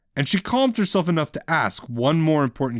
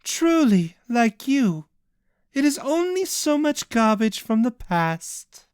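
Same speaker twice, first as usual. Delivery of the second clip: higher, cool contemptuous jibe; 10 semitones higher, slower than usual. Acted. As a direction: toss the insult lightly. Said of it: "the jibe".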